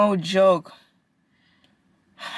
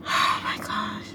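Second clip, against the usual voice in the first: whispered; 4 semitones higher, 5 dB quieter than usual. Real, not acted. Worn out, breathes the words.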